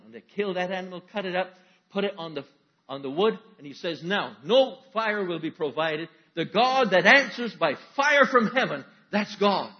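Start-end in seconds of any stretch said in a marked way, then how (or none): 4.0-9.7 slow, loud, high decree; booming slow pronouncement; loud proclamation. Acted. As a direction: proclaim the terms to everyone. Elijah sets the terms.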